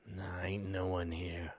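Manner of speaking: low, soft, slow dismissal; surly dog owner. Acted. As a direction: send them off quietly in a low voice. Game master voices surly man.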